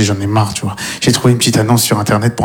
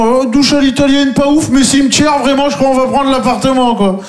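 much higher, gushing buyer voice; mock dreamy couple; 12 semitones higher than usual. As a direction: parody a starry-eyed house hunter, pitched way up. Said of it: "il y a des gens".

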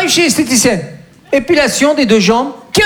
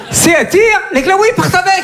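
yelled punchline; 8 semitones higher than usual. Acted.

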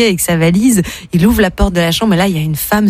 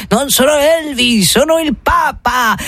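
slow, higher, stretched Italian protest; Italian pope accent; 6 semitones higher, slower than usual. Acted. Pope correcting his fans, pleading Italian accent. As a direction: insist as a hammy Italian pope.

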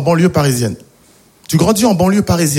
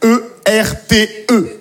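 spelled out slowly; much slower than usual. Shown as a demonstration. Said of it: "Ça s'écrit C".

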